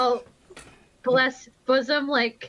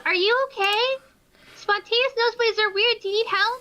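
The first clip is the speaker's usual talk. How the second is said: higher, earnest concern; innocent concern; 8 semitones higher than usual. Acted. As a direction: sweetly ask if they need help. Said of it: "and goes".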